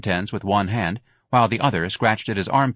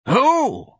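much higher, louder crabby snarl; old woman's snarl; 5 dB louder than usual. A narrator's reading. Old clerk snarling at the manager. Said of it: "Miss Trixie snarled".

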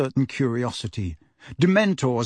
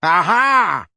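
triumphant roar, loud and higher; 5 semitones higher, 6 dB louder than usual. A narrator's reading. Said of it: "roared Uncle Vernon".

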